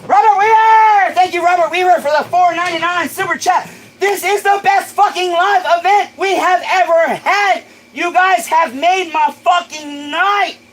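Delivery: high and loud, overjoyed; excited shout. Real, not acted. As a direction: yell it, over the moon with the chat. Another super chat arrives.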